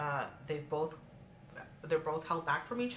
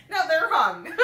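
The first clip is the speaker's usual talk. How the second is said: laughing; 10 semitones higher, 11 dB louder, slower than usual. Real, not acted. Repeat, louder and laughing.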